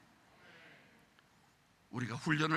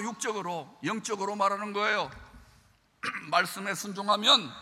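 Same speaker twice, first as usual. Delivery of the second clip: normal talking voice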